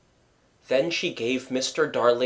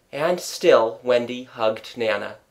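ordinary delivery, nothing marked

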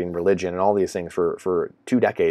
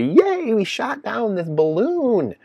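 much higher, slow, sing-song fake cheer; sarcastic cheer for a downed balloon; mock cheering; 13 semitones higher, much slower than usual. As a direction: cheer it like a dim fan. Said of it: "cheering on the beach".

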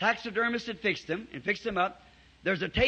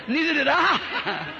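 slow words pushed out through laughter; laughing reply; 9 semitones higher, 8 dB louder, slower than usual. Retold or imitated. Preacher laughing as he retells his answer to Bud.

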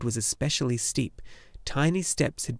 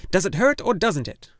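higher, louder impatient demand; scolding gnome healer; 7 semitones higher, 6 dB louder than usual. A narrator's reading. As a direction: press him like a cross old nurse. Urgle demanding.

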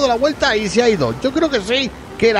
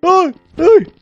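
high startled cries; being chased; 6 semitones higher, 5 dB louder, much slower than usual. Real, not acted. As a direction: yelp as it comes closer. Creature coming at him.

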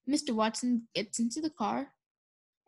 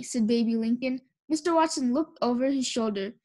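usual speaking voice

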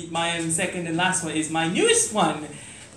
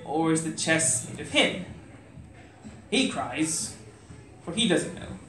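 slow, hushed spoken word delivery; poem recitation; 5 dB quieter than usual. Acted.

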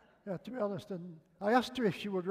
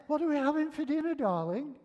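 high, quick, insistent call; comic retold holler, rushed and high; calling out again; 9 semitones higher than usual. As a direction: pitch it up and call down the hallway. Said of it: "I tried again".

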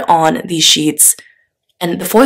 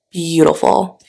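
ordinary delivery

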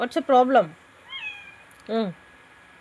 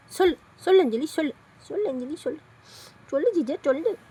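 high-pitched pet talk; high, repeated prodding; playful coaxing; 6 semitones higher, 3 dB quieter than usual. Real, not acted. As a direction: coax a pet to speak, high and insistent.

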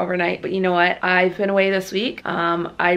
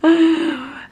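bright burst of laughter; laughing; 8 semitones higher, 4 dB louder than usual. Real, not acted. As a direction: laughs out loud. Laughing at partner's joke.

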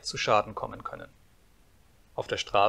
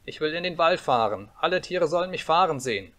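lightly voiced boy, higher and insistent; demanding little boy; 6 semitones higher, 4 dB louder than usual. A narrator's reading. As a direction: demand it like a bossy child. Boy making demands in story. (fordernder kleiner Junge)

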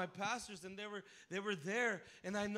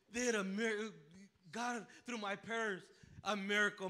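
normal talking voice

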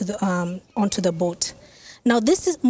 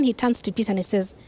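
ordinary delivery

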